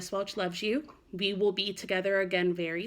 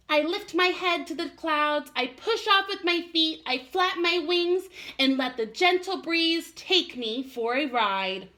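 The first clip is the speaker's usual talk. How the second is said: lightly higher little bird voice; a hint of a small chirping bird; twittering robin; 9 semitones higher, 4 dB louder, slower than usual.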